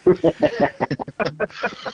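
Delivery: real amused laughter; laughing. Real, not acted. Caller reacting to the niche audience joke, laughing.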